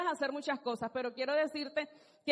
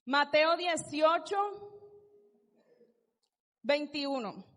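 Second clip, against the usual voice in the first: slow announcement; 2 semitones higher, 4 dB louder, much slower than usual. Real, not acted. Preacher announcing the scripture to the congregation, slow and louder. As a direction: call out Mateo dieciocho slowly and louder.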